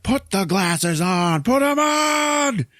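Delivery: long, high, drawn-out shout; hammed-up demand. Rowdy demanding it of Keith David mid-fight, hammed-up shouting. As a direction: stretch every word into a mock yell.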